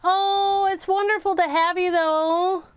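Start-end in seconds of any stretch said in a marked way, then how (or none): none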